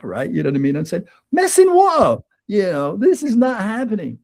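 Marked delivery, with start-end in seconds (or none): 1.3-4.2 high, louder indignant protest; retold protest to his wife outside the bathrooms; indignant disbelief. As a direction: refuse it flatly, voice jumping up. Retold argument in the archway.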